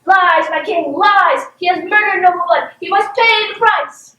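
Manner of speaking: storybook villainess crying out; dramatic outcry. Acted. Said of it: "And Lady Vidma cries".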